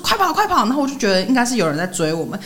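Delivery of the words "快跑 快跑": urgent cry. Retold or imitated. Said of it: "然后他就说".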